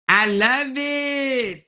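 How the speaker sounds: delighted. Real, not acted. Delight at support.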